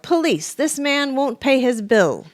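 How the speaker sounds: high loud accusing call; yell. A narrator's reading. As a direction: shout it to the street. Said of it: "Freddy yelled".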